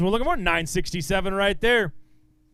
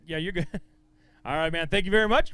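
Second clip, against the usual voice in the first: usual speaking voice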